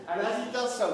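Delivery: normal talking voice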